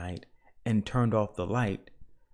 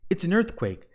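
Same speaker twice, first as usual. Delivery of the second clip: higher, alarmed cry; panicked shout; 9 semitones higher, 4 dB louder than usual. A narrator's reading. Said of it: "shouted Missus Watson".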